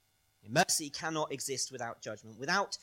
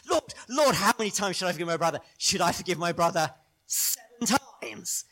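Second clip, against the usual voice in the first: high, loud, over-keen questioning; eager pupil pressing for approval; eager Peter; 5 semitones higher, 8 dB louder than usual. Acted. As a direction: blurt it out, desperate to get it right. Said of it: "you can almost feel it in Peter's voice".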